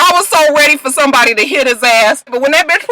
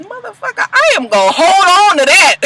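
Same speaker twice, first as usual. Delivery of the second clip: delighted outburst; 10 semitones higher, 3 dB louder than usual. Real, not acted. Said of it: "I said".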